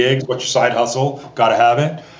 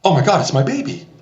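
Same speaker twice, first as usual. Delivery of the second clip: mom recognizing son; 6 semitones higher than usual. Retold or imitated. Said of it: "all of a sudden she's like".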